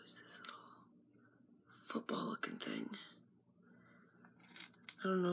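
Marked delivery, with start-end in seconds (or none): 0.1-2.9 soft unvoiced whisper; whispered question. Real, not acted. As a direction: breathe it out without voice. Whispering while kids nearby.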